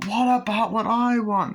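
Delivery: high, loud, slow plea; hammed-up aggrieved outburst; mock upset protest. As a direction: act out a child crying out to parents. Acts child protesting to parents.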